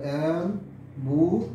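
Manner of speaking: Ambulance drawn out slowly in three separate syllables. Shown as a demonstration. Teacher counting syllables for students, ambulance slowly broken into three beats. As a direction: split ambulance slowly into am, bu, lens. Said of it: "তাহলে তিনটে syllable".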